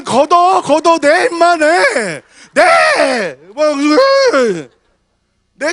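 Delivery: loud, high, pushy demands; mock offering collector. Pastor mocking another church's pushy offering collection, loud. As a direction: bark at them to hand it over.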